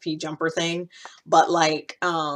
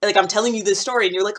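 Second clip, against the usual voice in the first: ordinary talk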